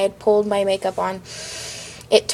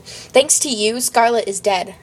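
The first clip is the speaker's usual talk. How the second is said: usual speaking voice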